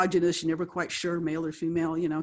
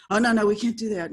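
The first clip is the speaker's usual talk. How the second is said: louder, higher imitation of alarm; mock alarmed refusal; 4 semitones higher, 8 dB louder than usual. Imitating executives turning down her suggestion, mock alarmed. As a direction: protest loudly in mock dismay.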